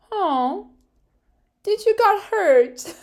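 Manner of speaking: put-on high, slow, loud baby-talk cooing; mock pity. [name] mocking Pierre after the shove, in sarcastic baby-talk cooing. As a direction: coo at him like a hurt baby. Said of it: "this kind of thing".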